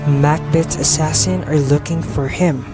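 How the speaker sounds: normal talking voice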